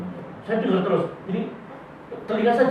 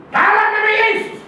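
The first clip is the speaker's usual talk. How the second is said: much higher, proclaiming in Jesus' name; hammed-up shrill... no, high mock prayer proclamation; mock pastor's prayer cry; 13 semitones higher than usual. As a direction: cry it out like a fervent woman pastor. Woman pastor praying over man.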